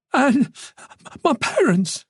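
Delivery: high, alarmed voice; frightened realisation; dawning panic. A narrator's reading. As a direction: let the fear push the pitch right up. Realises parents are in danger.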